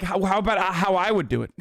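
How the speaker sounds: ordinary talk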